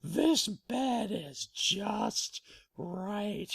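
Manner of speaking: slow, drawn-out blissful sigh of words; Goldilocks content. Acted. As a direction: stretch it out as if sinking into bed. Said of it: "And she goes".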